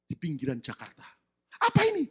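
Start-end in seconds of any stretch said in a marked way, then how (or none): 1.5-2.0 high, loud yelp of surprise; startled question. Retold or imitated. Wife shown a bundle of land certificates, startled question. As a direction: blurt it out, taken aback. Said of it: "Kaget ini wanita".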